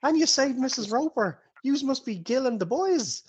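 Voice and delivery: high, eager barmaid gushing. Acted. Barmaid greeting Gil and the boys. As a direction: fuss over the heroes.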